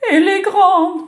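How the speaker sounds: put-on high, loud sobbing child. Frightened girl asking about big girls, high sobbing. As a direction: sob out the worry. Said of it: "sanglote M Toto".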